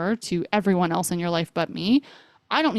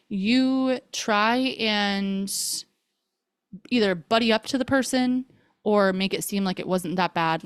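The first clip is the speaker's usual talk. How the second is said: ordinary talk, nothing marked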